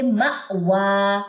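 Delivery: very slow مأوی with a sharp stop on the hamza; jolt on hamza. Shown as a demonstration. Teacher drilling مأوی slowly, hamza jolted. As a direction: break مأوی with a glottal catch. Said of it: "اس پہ ہم جھٹکا دیں گے".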